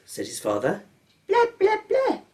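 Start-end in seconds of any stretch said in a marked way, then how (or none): 1.3-2.2 put-on very high baby babble; baby George babbling on purpose. A narrator's reading. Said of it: "said George".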